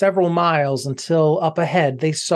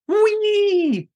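very high, squealing whee; gleeful shout. Acted. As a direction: shriek for joy. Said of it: "he shouted with glee".

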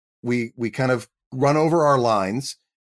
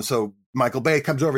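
ordinary delivery

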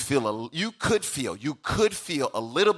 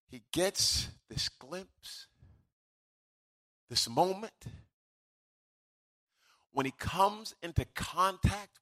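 soft, slow, long pauses; hushed gravity; 3 semitones higher, 6 dB quieter than usual. Acted. Preacher telling of prophet seeing his brokenness, hushed gravity.

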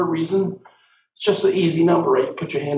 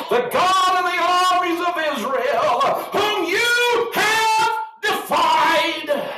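preaching at full force; slower than usual. Real, not acted. Preacher at the peak of the sermon, high and sung. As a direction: stretch the last words into a shout.

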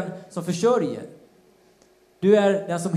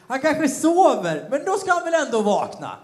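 laughing taunt; 8 semitones higher, 4 dB louder than usual. Acted. Elijah teasing Baal's prophets, laughing as he taunts. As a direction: jeer at them while chuckling. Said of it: "Då retades Elia med dem".

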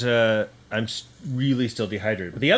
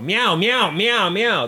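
high, loud cat meows; cat meowing; 10 semitones higher, 7 dB louder, much slower than usual. Retold or imitated. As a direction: meow like an angry cat. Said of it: "see another person ... and go".

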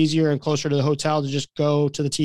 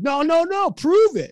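high, insistent mock demand; retold challenge; 12 semitones higher, 3 dB louder than usual. Players refusing to let the coach go, high-pitched challenge. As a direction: dare them, imitating a stubborn group.

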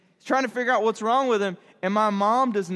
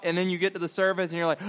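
ordinary talk, nothing marked

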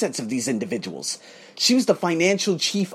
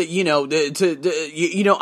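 ordinary talk, nothing marked

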